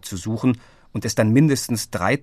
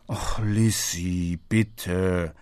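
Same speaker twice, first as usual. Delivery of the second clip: slow, softer, lower weary groan; groaning father; 4 semitones lower, 4 dB quieter, much slower than usual. A narrator's reading. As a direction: sigh out the plea like a tired dad. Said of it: "stöhnte Herr Meyerbeer". (Vater stöhnt müde)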